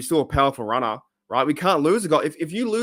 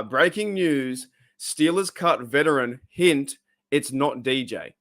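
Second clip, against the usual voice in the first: slow, deliberate headline read; reading the title slowly; slower than usual. Shown as a demonstration. Reading his video title slowly to viewers, deliberate. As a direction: spell out the title word by word. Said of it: "my title was".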